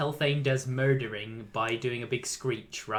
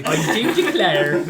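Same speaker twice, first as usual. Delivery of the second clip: put-on louder hammy Welsh accent; theatrical Welsh declaration; 9 dB louder than usual. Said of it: "why did you have to do Welsh?".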